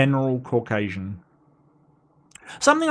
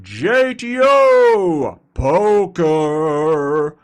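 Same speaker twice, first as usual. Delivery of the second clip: slow, higher, louder name call; fighting game announcer; 9 semitones higher, 5 dB louder, much slower than usual. Acted. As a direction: boom out the names like a ring announcer. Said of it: "announcer voice".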